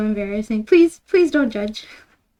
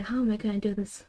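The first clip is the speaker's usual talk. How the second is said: quick soft mutter under the breath; muttered to self; 9 dB quieter, faster than usual. Real, not acted. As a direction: rush it quietly, half to yourself. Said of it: "I don't know how to explain this".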